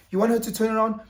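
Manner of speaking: usual speaking voice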